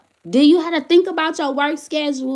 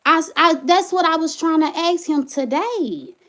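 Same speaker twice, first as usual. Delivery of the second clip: ordinary delivery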